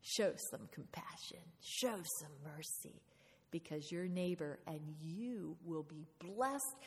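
whispered encouragement, slow; a gentle hushed cheer from God at your side; God cheering you on. Pretend. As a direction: softly urge them on in a hushed voice. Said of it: "he's, like, cheering you on, going".

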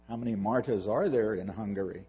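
ordinary delivery

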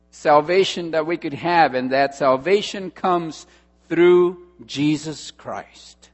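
loud preacher, punching each phrase; rising revival preaching; full-force preaching. Acted. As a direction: drive the gospel home to the congregation. Preaches the application aloud.

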